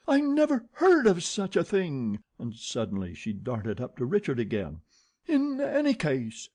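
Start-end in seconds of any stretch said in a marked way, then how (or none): 0.1-2.2 high, loud indignant shout. A narrator's reading. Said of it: "She shouted". 5.3-6.5 high sharp yelp. A narrator's reading. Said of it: "she yelped".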